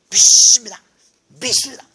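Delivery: mock shouting to force belief; forced belief. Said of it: "안 믿어지니까 또". (억지로 믿으려는 듯 크게 외치는 흉내)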